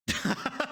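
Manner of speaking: high burst of laughter. Real, not acted. Streamer cracking up at their own taxi story, laughing.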